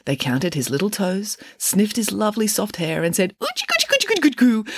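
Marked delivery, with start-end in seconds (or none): 3.4-4.7 put-on very high-pitched cooing at a baby; squeaky-high gushing baby babble; baby talk. A narrator's reading. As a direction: coo sillily over a newborn. Shepherds coo over newborn.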